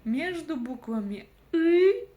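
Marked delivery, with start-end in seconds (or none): 1.5-2.1 "ы" much louder and higher, held; loud drawn-out teaching "ы"; demonstrated vowel Ы. Shown as a demonstration. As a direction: punch out an isolated "ы". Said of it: "среднее по мягкости между буквами".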